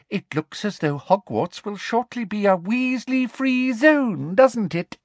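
high, smug schoolmistress voice; gloating villainess. Acted. Umbridge gloats over captured Weasleys, smugly.

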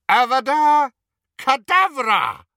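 high, loud, vicious curse; casting a curse. Acted. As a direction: spit out the killing spell. Wizard casts killing curse.

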